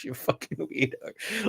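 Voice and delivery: slow, whispered quote of an insult; amused hushed retelling of a heckle; quoted insult. Retold or imitated. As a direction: read the insult out slowly under your breath. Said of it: "Somebody actually said in the event".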